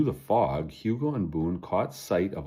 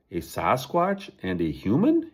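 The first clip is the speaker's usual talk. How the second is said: scoffing; 5 semitones higher than usual. Acted. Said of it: "he snorted".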